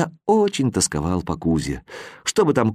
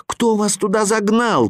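shout up; 10 semitones higher than usual. A narrator's reading. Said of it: "крикнул я им снизу".

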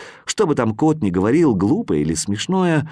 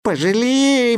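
begging; 13 semitones higher, slower than usual. A narrator's reading. Chukchi boy begs Viktor for mercy, high desperate plea. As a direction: beg for mercy. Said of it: "взмолился маленький Чукча".

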